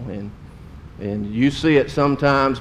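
ordinary talk, nothing marked

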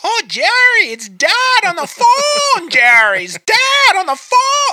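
very high, loud mock wife calling Jerry; annoying movie wife. Mimicking the wife calling Jerry to the phone, very high and loud. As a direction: do the nagging wife in a very high, loud put-on voice, slow on the phone lines. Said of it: "the most annoying voice in the whole movie, ... first goes to his wife".

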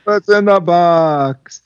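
high, slow, dramatic; movie quote. Retold or imitated. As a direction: draw out the famous line. Echoes movie line.

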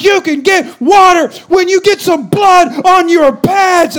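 loud, high, slow coach shout. Retold or imitated. Coach refusing water to the player, shouting. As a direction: bark the harsh answer. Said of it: "He goes".

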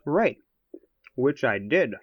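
talking through chocolate; words round a bite of chocolate; mouth full. Real, not acted. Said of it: "I'm eating a piece of chocolate here".

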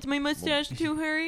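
high-pitched mocking whine; put-on high voice for a silly worry; mock fussing. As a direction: imitate a fretful question up high. Mocking someone worrying about their mustache.

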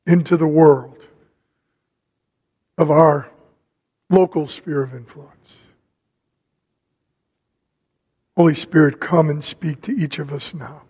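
low, measured prayer with long pauses. Real, not acted. Preacher praying for the Spirit to speak to us, prayerful.

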